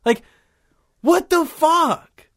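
high, stunned disbelief; aghast, voice climbing high; retold disbelief. Telling the listeners how he reacted on watching the video, stunned. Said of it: "I was like".

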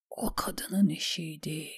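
soft, slow half-whisper; weaker voice. A narrator's reading. Davos repeats that it was the woman's doing, weakly. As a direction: say it faintly, as if strength is failing. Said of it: "daha zayıf bir sesle".

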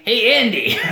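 high, loud put-on call; mock doll voice. Imitating Chucky calling to Andy, high doll voice. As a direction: call out as a killer doll playing friendly. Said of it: "He's like".

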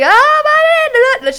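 high, loud mock rant; imitating the driver's yelling. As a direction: yell to nobody like the driver. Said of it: "she's like".

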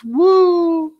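high, drawn-out whoop; cheering woo. Real, not acted. Cheering the Mario casting to friends, high whoop. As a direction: let out a high, held "woo".

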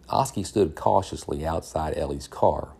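usual speaking voice

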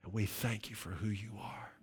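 soft, hushed prayer with long pauses; closing prayer. Real, not acted. Preacher prays to the Father to close the service, hushed prayer. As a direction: pray gently, fading almost to a whisper by the amen.

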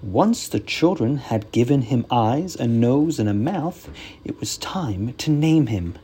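usual speaking voice

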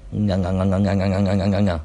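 rhythmic "nga" practice; drilling the ng sound. Shown as a demonstration. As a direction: drill "nga" over and over like a teacher. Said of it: "That's a word for you guys to say".

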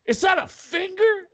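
high, loud alarmed ask; startled question. Retold or imitated. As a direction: sound suddenly spooked. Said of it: "He's like".

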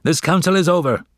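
high, quick, final ruling; heated father, pitch jumped up; blazing anger. A narrator's reading. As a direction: close the matter hotly and fast. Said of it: "blazed Father".

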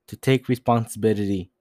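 ordinary talk, nothing marked

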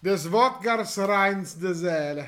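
put-on slow, hammy Russian accent; mock Russian accent, drawn out; russian accent in german. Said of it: "mit einem leichten russischen Akzent".

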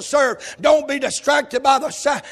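loud, rhythmic preacher's cry; hammering revival preaching; preaching at full force. Acted. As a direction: shout the night watch sermon to the church.

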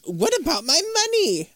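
high-pitched whining demand; Sardo. Retold or imitated. Acting Sardo demanding his payment.

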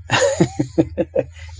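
a short burst of real laughter. Real, not acted. Preacher laughing about the pothole-filled road, a real laugh. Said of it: "you will understand why I'm laughing".

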